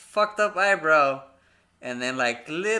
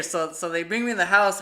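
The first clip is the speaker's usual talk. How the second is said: usual speaking voice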